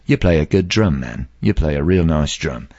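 lightly voiced low British rocker. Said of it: "Bowie looks in the room and goes".

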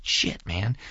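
whispered in disbelief; hushed, stunned curse; awed whisper. Retold or imitated. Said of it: "I'm just like".

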